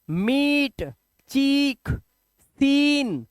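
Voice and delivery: मीट, चीक, सीन each with a long ee; word by word, stretching the ee in मीट, चीक, सीन; long-vowel demo. Shown as a demonstration. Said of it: "अब कैसे पढ़ेंगे".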